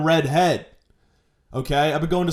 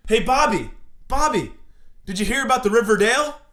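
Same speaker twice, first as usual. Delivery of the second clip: put-on high, bright teen-drama voice calling to Bobby; Riverdale teen character; 7 semitones higher than usual. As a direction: play a high-pitched teen soap character. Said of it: "Let's do a scene".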